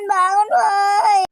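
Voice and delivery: high, long-held piercing scream; screamed wake-up call. Acted. Girl yelling at sleeping friends to get up, screaming. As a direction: squeal it out to rouse the whole room. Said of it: "gak usah teriak-teriak".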